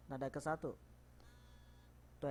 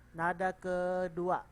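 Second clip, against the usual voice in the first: slow announcement; 3 semitones higher, 6 dB louder, much slower than usual. Shown as a demonstration. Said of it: "kita mulai aja".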